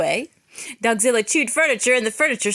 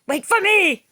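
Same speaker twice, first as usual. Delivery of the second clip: higher, louder plea; desperate call; 10 semitones higher, 6 dB louder than usual. Acted. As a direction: call out while chasing the troops. Big Cheese runs after troops.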